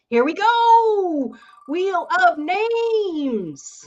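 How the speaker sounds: slow, high game show announcer; hyped game show voice. Acted. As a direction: drag out the wheel name like a TV host. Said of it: "That sounds horrible".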